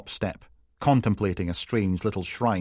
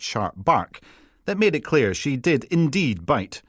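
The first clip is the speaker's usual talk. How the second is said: usual speaking voice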